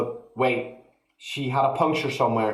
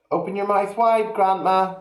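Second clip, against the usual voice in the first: put-on higher, louder boyish coaxing; 9 semitones higher, 4 dB louder than usual. A narrator's reading. George telling grandma to open wide, higher and louder. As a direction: coax like an eager lad with a spoon.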